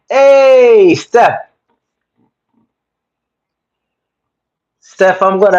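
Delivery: loud, high hey to a friend; light up as she joins; excited greeting. Real, not acted. Greeting Stephanie as she joins the live stream, excited call.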